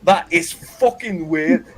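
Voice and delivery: ordinary talk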